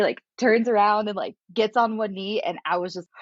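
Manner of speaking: ordinary delivery, nothing marked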